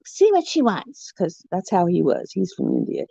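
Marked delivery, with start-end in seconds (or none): 0.1-1.1 imitating a rude doctor, higher pitched; mock an impatient doctor's demand, pitched up. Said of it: "He's just really rude".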